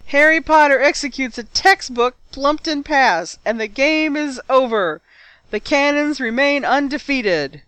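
high, loud, triumphant play-by-play; match commentator. A narrator's reading. Lee announcing the Cannons' win, triumphant commentary. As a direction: override the objection and declare victory. Commentator talks over the objection.

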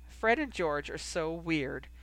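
ordinary delivery, nothing marked

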